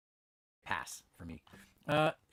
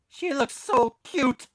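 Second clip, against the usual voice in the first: cooing over statue; 12 semitones higher, 11 dB louder, slower than usual. Real, not acted. Admiring the statue.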